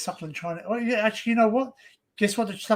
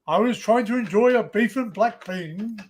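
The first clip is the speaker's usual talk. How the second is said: mock declamation; 2 semitones higher than usual. Mimicking a man protesting his arrest over dinner, hammed-up declamation. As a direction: declaim it like a pompous stage actor. Quoting a meme speech in mock grandeur.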